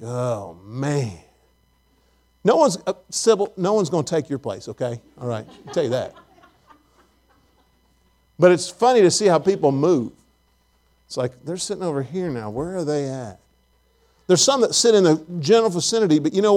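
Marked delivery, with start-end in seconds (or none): 0.0-1.2 low, soft grumbling aside; hushed, low mock dismay; mock grumble. Mimics grumbling over changed seats. 11.4-13.4 hushed, low aside to a neighbor; soft, low mock nosiness; mock gossiping aside. Mimics churchgoers gossiping about seats.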